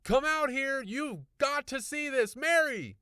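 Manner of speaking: high, loud, eager shouting; excited calling. A narrator's reading. Husband calling wife to see floating tomatoes, excited loud. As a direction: urge her to come see, voice racing high. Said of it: "calling to his wife".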